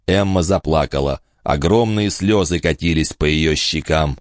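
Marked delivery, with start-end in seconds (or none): none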